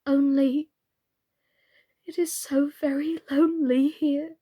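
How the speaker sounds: a touch of sadness; wistful, lightly sad; melancholy. Acted. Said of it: "in a melancholy voice".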